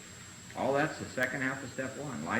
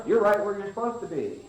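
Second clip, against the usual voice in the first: higher and louder, sing-song cheerful cliché; mock sweet platitude; 8 semitones higher, 6 dB louder than usual. Member greeting a struggling newcomer, mock sing-song cheer. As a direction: parrot the cheery slogan back. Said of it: "people would walk up and say".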